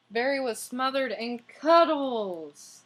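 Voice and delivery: dramatic storybook emphasis; emphatic read-aloud. Shown as a demonstration. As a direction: lean into smothered in cuddles. Reading aloud, emphatic narration.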